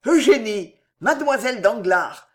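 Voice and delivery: ordinary delivery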